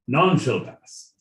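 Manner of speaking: royal British at an ordinary pitch, falling at the end; royal British accent. Acted. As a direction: bring the regal British down to a firm close. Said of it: "in the royal British accent".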